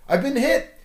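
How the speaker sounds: sharp high yell into the comlink. A narrator's reading. Luke yells to pilots that he is hit, high and loud. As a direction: cry out as the ship is hit. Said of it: "he yelled in his comlink".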